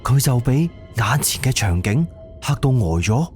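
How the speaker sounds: ordinary talk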